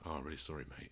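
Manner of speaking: quiet, sincere condolence; soft sympathy. Retold or imitated. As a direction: offer it gently to another parent. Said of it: "looking at them going".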